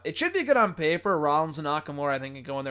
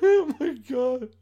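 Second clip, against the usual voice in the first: real high, loud laughing fit; cracking up, voice way up; helpless laughter; 8 semitones higher, 5 dB louder, slower than usual. Real, not acted. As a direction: gasp it out through loud laughter.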